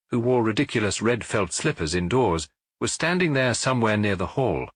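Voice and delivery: plain reading voice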